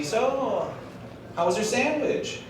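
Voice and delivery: high, light server voice asking politely; imitating the waitress checking on the table; server's question. Server asks the customer about his sandwich, high polite voice.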